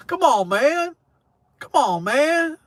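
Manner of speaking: a light take on Biden's catchphrase; Biden impression. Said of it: "talk like fucking Biden".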